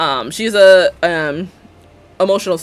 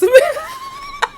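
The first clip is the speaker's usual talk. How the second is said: high squealing laugh through the words; laughing shout. Real, not acted. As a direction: burst out laughing mid-line. Laughing at the game.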